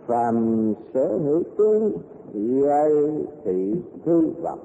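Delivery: slow, measured dictation pace; dictating. Shown as a demonstration. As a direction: read it out word by word. Dictating quoted sutra line.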